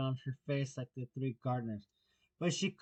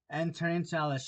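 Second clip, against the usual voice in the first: ordinary delivery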